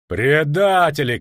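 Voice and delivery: loud, slow furious shout; angry shout. A narrator's reading. Officer shouts at the deserting soldiers in rage. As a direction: spit the word at the traitors. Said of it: "крикнул Дакр".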